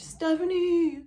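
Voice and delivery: high urgent pleading voice, pitched well up; frantic friend. Retold or imitated. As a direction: act out a panicked friend summoning her. Friend calling in panic, voiced.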